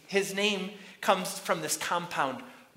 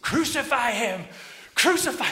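loud, high mob shout; crowd yelling; 8 semitones higher, 8 dB louder than usual. Acted. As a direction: yell it out for the whole square to hear. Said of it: "They start yelling back again".

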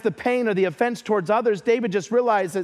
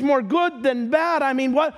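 usual speaking voice

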